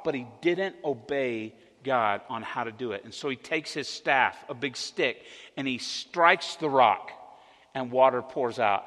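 ordinary talk, nothing marked

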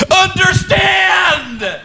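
very high, stretched-out shout; hammed-up yell at a struggling student; mock instructor's yell. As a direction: shriek the one word slowly. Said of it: "His primary mode of instruction is yelling".

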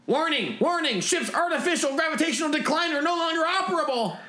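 very high, monotone computer alert; ship's warning alarm. Acted. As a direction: blare it like an automated alarm. Ship's alarm announces a failure.

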